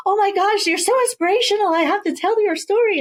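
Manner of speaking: high, gushing fan excitement, retold; bubbling over. Author retelling her first phone call to her hero, bubbling high and excited. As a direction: gush like a starstruck fan meeting her hero. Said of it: "bubbling, like".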